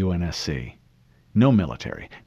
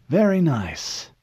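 slow, high appreciative murmur; savouring old admiral; 8 semitones higher, 3 dB louder, slower than usual. Acted. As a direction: savour the whiskey.

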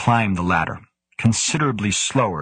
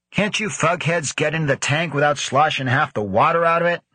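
higher, caustic nasal complaint; scornful nasal grousing; 8 semitones higher than usual. A narrator's reading. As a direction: sneer it through the nose. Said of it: "groused a scornful nasal voice, caustically".

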